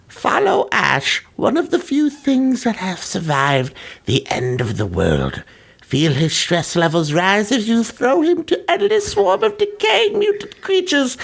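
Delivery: high, eerie storybook menace; creepy voice. Pretend. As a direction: read it like a creepy villain. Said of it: "to do my creepy voice".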